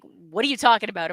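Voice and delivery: ordinary talk, nothing marked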